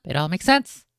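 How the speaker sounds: loud, jokey conspiracy yell; hammed-up certainty, shouted; mock shout. Friend jokes it all adds up, shouting.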